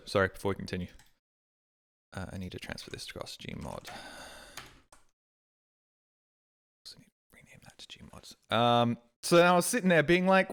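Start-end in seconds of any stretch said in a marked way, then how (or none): 2.2-8.6 much softer, whispered to himself; quiet aside. Real, not acted. Streamer sorting files mid-story, soft whispered aside. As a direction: drop to a private whisper while fixing something. Muttering aside while doing setup.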